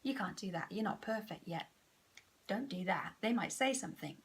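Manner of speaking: low and hushed nagging voice; soft inner critic. Acted. The gorilla ego undermining her, in a hushed murmur. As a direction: murmur the doubts under your breath. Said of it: "throw out some little cards to say".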